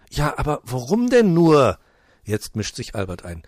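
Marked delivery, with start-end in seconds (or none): 0.1-1.8 bewildered director. Acted.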